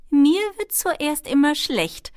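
slow, high, loud character voice; kobold girl. A narrator's reading. Kobold confides in Ben about flight sickness, kobold voice.

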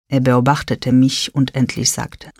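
ordinary delivery

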